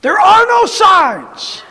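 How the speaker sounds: high slow declaring shout; emphatic shout. Acted. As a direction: proclaim it slowly and loud.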